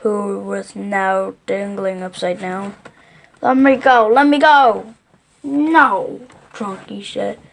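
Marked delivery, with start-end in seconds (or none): 3.4-4.9 loud, higher-pitched protesting yell. A narrator's reading. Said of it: "yelled the enormous crocodile". 5.4-6.2 loud, raised-pitch "no"; firm loud refusal. Acted. Elephant firmly refuses captive crocodile.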